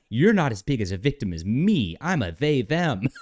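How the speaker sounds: hammed-up whiny soy voice; mocking soy voice. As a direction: whine it as a hammed-up victim. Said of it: "soy voice".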